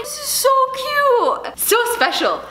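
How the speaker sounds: high, slow squeal; gushing. Real, not acted. Unwrapping a fan's gift, squealing this is so cute. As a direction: squeal with delight.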